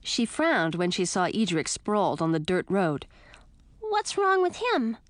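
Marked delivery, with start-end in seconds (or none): 3.8-5.0 much higher little bat voice; high tiny creature chatter; squeaky bat voice. A narrator's reading. Lil the bat's voice.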